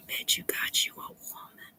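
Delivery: whisper. Real, not acted. Teasing Raymond about being married.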